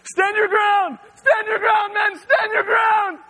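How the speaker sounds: high, loud shouting order; frantic commander shouting. Retold or imitated. Guard captain rallying his thirty men, high panicked shout. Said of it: "the guy with the keys in charge is like".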